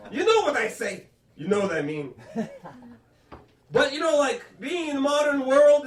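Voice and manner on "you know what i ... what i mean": dismissive French Canadian mother; French Canadian accent. Retold or imitated. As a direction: brush it off in French Canadian English. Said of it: "That was my mother".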